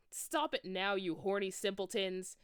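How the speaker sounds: loud scolding shout. Acted. McGonagall catching the couple, loud scolding shout. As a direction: bark it at the couple. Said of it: "shouted Professor McGonagall".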